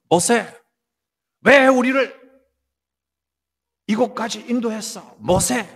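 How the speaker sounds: acted-out attack on Moses; accusing. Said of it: "모세를 향하여 공격을 하는 거예요".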